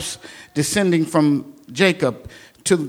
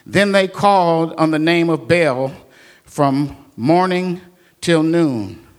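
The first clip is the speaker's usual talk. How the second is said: ordinary talk, nothing marked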